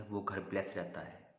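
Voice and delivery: normal talking voice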